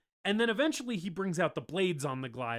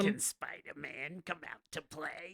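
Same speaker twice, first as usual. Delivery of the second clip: soft, creepy sing-song villain voice; hushed playful menace; goblin taunt; 8 dB quieter than usual. Retold or imitated. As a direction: taunt softly, like a goblin down the phone. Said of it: "hears the goblin cack-, cackling on the other end".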